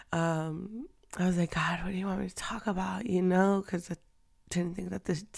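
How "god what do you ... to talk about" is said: hushed, fast whisper to God; whispered prayer. Retold or imitated. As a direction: whisper the plea quickly under your breath.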